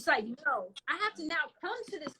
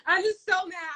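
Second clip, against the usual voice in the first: louder, higher mock venting; acted-out burst of annoyance; retold frustration; 5 semitones higher, 8 dB louder than usual. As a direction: vent it in a raised, exasperated voice. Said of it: "I've been so frustrated".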